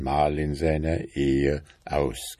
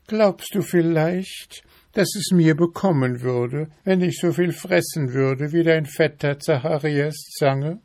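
high-pitched, fussy wife voice, louder; nagging wife beetle; 11 semitones higher, 4 dB louder than usual. A narrator's reading. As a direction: pester him in a high, insistent voice. Wife questions husband.

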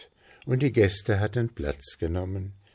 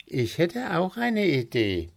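higher, lightly voiced helpful friend; offering an idea eagerly, a touch higher; Willi chiming in; 11 semitones higher than usual. Friend offers a cake name, lightly voiced higher.

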